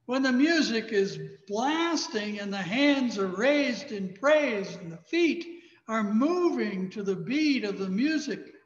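normal talking voice

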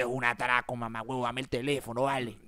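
low, menacing robber voice. Acted. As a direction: growl it like a street thug. Asaltante amenaza, voz impostada. (voz grave de atracador)

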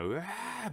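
high, drawn-out mock yowl like a cat in heat; imitated cat in heat. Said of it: "発情期の猫みたいな". (発情期の猫の高い鳴き真似)

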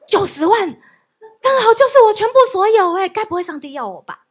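loud, high startled exclamation; jolted as if by a stun gun; shocked inner thought. Retold or imitated. Shocked inner thought on hearing price.

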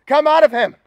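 loud, high stern order; stern command. A narrator's reading. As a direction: cast it out with a forceful command. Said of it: "said Jesus sternly".

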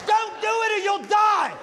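hammed-up indignant rant, topping out in a shout; mock outrage. Mocking eighties lesson.

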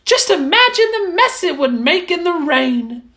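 high, pleased little mouse voice; a small mouse admiring a cozy hut. Acted. Mouse admiring the straw hut, high and content.

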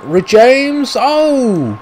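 high excited call of the player's name; yelling the player on, high; excited cheer. Real, not acted. Gamer urging his player on, high excited call.

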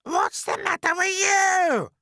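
piercing high, loud screech. A narrator's reading. Aunt Spiker catching James idle, screeching. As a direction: screech it at the boy. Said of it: "Aunt Spiker screeched".

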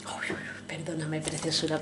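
breathy little uy uy uy as it breaks; alarmed whisper. Real, not acted. Showing the broken figure to viewers, a startled whisper. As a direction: whisper it as the figure comes apart. Craft figure falling apart.

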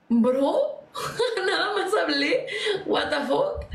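laughing through a baffled protest; half-laughing, can't believe it; laughing disbelief. Retold or imitated. Retelling the gamers' insults, laughing in disbelief. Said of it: "Y yo como de".

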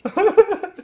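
高声大笑; 放松后忍不住高声笑出来; 笑出声. Real, not acted. Laughing after tube is removed. (如释重负地大笑)

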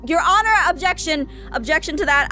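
shouted courtroom objection; loud objection. Acted. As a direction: call out the objection loudly.

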